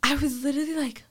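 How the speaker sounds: normal talking voice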